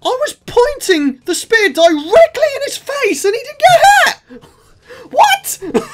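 high, loud indignant squeal; can't believe the spear missed; outraged disbelief. Real, not acted. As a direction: shriek it like the game just cheated you. Outraged at invincible mob.

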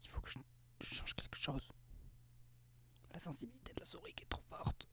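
real whisper, far from the mic; whispering aside while fixing settings. Real, not acted. Streamer muttering to himself about mouse sensitivity, whispered.

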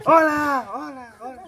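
high, loud call fading like an echo; calling into a cave. Retold or imitated. A gangoso calling into a cave, loud call. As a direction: shout hello into a cave. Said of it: "dije que".

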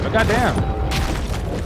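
slow, whispered, drawn-out goddamn; breathy awe. Real, not acted. Awed at a beefy shark.

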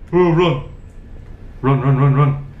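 loud urgent run calls; urgent shout. Real, not acted. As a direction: urge yourself on, loud. Fleeing the monster.

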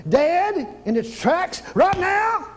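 a sudden preached command; commanding burst. Shown as a demonstration. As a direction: command it to stop dead. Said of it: "preacher demos command".

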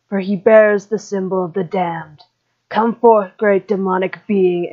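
usual speaking voice

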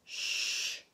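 long "shh"; drawn-out shushing; whispered shush. A narrator's reading. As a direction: hush them with a stream of air. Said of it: "whispered James".

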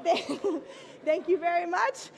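thanks broken up by real laughter; laughing thanks. Real, not acted. Thanking the president for a free building, laughing. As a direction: laugh through the thank-you.

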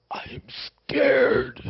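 breathy frightened whisper, high and slow; a god afraid. Retold or imitated. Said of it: "he literally says the words".

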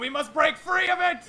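shouting, high-pitched end-times prophet; raving doomsayer. Acted. Street prophet warning all who will listen, raving. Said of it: "Stop yelling".

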